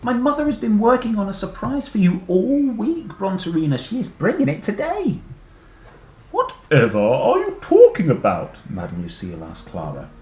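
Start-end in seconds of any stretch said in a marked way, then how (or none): 0.0-5.2 high, loud pleading; eager little girl. Acted. Clara begs the dinosaur to stay, high and eager. 6.3-8.6 loud, grand puzzlement; puzzled teacher. Acted. Teacher asks Clara, loud and puzzled.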